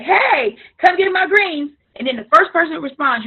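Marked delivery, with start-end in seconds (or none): 0.0-1.7 mock eager sales post. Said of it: "be like".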